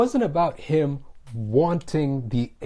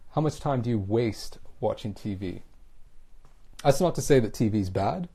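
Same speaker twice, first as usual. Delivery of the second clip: ordinary talk, nothing marked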